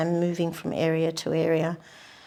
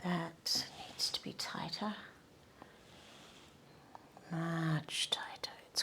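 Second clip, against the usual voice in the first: whispering; 13 dB quieter than usual. Real, not acted. Painter talking herself through a tight stroke, whispering. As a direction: murmur it under your breath while concentrating. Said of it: "I don't know why I'm whispering".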